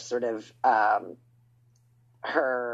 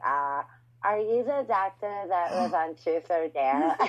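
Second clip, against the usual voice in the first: tongue held out; 4 semitones higher, slower than usual. Retold or imitated. Singer at the throat doctor, tongue held, garbled. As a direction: mouth held open, garbled. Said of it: "I'm just like".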